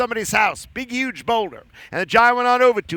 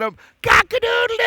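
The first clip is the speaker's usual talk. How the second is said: rooster crow; 12 semitones higher than usual. Acted. Giant trying to whistle, high rooster crow. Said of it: "that's a rooster".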